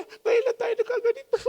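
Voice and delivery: high, wavering imitation of a wife in happy tears; tearful wife. Crying wife answering at Christmas, imitated high and tearful. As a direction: voice her moved reply up high. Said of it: "umiiyak, nagpapasko".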